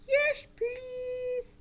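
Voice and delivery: high puppet voice; high, drawn-out puppet reply; polite puppet Johnny. Pretend. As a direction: answer as a polite puppet pupil. Puppet Johnny replies to teacher.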